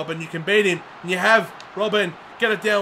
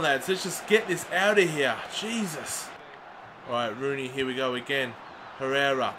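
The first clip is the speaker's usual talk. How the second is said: normal talking voice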